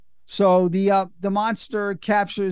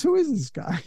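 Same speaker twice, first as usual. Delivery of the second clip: put-on a light American accent, pitched higher; lightly voiced all-American guy, a touch higher; perfect American accent; 7 semitones higher, 3 dB quieter than usual. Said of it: "in a perfect American accent goes".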